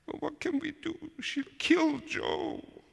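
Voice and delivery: very high, slow tearful wail; squeaky, drawn-out crying voice; crying child. A narrator's reading. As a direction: wail helplessly like a little sister in tears. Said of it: "My sister started to cry".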